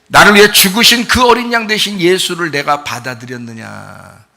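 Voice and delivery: ordinary delivery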